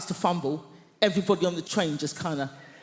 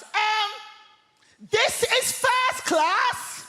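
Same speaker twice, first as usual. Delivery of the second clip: a snobby passenger shouting at the newcomers; snooty shout; 13 semitones higher, much slower than usual. Retold or imitated. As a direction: drag out a haughty rebuke. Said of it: "at the top of her voice, she shouts".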